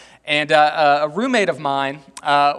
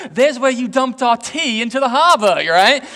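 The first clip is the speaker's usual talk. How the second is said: British tour guide lilt, a bit higher; a light British accent, pitched up; 5 semitones higher, 6 dB louder than usual. Retold or imitated. British woman showing the harbor, mild British accent. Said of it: "she was a British woman".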